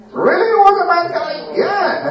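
high, quick disbelieving really; surprised disbelief. Acted. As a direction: ask it wide-eyed, as if it can't be true.

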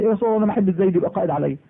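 fast, hurried objection; rushed complaint. Retold or imitated. Jafar hurrying his objection to the Prophet, quick and rushed. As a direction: rattle it off in one breath.

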